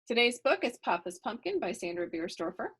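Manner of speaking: usual speaking voice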